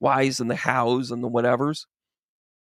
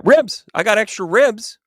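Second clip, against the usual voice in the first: eager idea; 7 semitones higher, 6 dB louder than usual. Acted. Adam has a bright idea.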